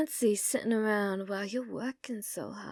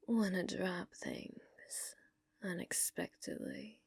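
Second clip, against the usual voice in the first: whisper; 3 semitones lower, 13 dB quieter than usual. Acted. Whispered veiled threat to bartender.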